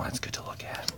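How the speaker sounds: hushed admiring whisper; soft unvoiced awe. Real, not acted. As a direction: let it out under your breath. Admiring card art.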